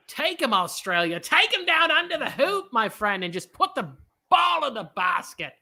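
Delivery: hammed-up Australian accent, lightly done; a clumsy Australian accent calling a play; terrible Australian accent. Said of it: "It's a terrible Australian accent".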